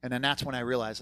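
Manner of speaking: normal talking voice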